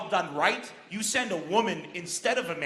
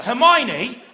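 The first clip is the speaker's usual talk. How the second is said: loud British cry from Harry Potter; British accent; 3 semitones higher, 12 dB louder than usual. Acted. Channelling Harry Potter, calling out Hermione, loud British accent. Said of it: "I channel Harry Potter".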